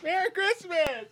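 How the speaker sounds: high, sung-out holiday cheer; festive shout. Acted. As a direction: belt it like a jolly greeting.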